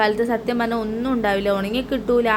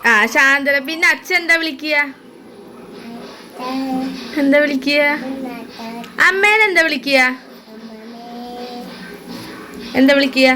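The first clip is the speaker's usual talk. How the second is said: high, loud singsong questions; 4 semitones higher, 4 dB louder than usual. Real, not acted. Mother quizzes child on names.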